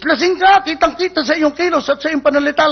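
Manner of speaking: high, insistent accusation; accusing policeman. Acted. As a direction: pounce on the drunk with the charge. Policeman insists the man is drunk.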